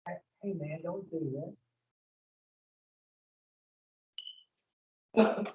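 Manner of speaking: very soft inner nudge; quiet voice of God. Acted. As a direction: barely voice it, a hushed warning from within. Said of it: "he says".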